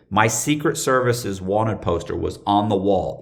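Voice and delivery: usual speaking voice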